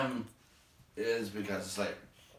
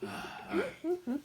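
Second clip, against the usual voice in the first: much higher, slow, laughing through the words; 4 dB quieter, slower than usual. Real, not acted. Teacher laughs at the textbook, moving on. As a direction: still chuckling at your own joke. Laughing after the sarcastic aside.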